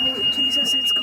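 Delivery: high squeal at the cold; shriek from cold. Real, not acted. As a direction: yelp out at the biting cold. Freezing ride in golf cart.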